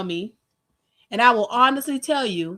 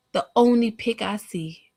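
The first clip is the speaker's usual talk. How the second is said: ordinary delivery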